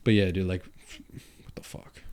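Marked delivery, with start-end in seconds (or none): 1.4-2.0 a whispered what the fuck. Real, not acted. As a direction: drop to a whisper for the punchline. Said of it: "it's funny you talk like that".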